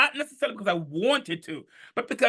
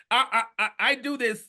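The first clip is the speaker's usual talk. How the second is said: ordinary delivery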